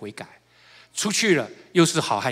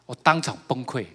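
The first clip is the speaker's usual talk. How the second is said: normal talking voice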